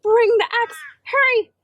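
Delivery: high, loud urgent shout. Acted. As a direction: yell for help across the garden. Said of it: "she shouted".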